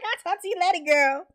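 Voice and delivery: high mock girlfriend sign-off; imitating a friend. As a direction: pitch it up high for a hammed-up goodbye. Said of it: "She's like".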